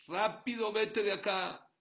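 furious yell to get out; angry shout. Acted. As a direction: bark him out of the office, loud and slow. Brother chasing him out.

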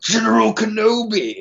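high-pitched, badly done villain impression; hammed-up, high villain greeting; General Grievous. Podcaster mimicking a game emote, badly done impression. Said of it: "the worst General Grievous impression".

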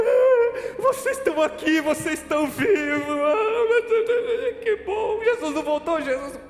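gritando agudo de alívio, entre choro e riso; alívio chorado. Retold or imitated. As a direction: explode de alívio, quase chorando. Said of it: "eu chego chorando". (alívio aos gritos e choro ao reencontrar a família)

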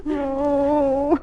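high, drawn-out wail; tearful. Acted. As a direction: start to cry on a long moan. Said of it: "then why are you crying".

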